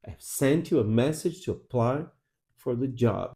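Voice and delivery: "I've sent you a message to apply for the job", pulses on SENT, MESS, PLY, JOB; stressed content words. Shown as a demonstration. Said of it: "Essas são as sílabas fortes".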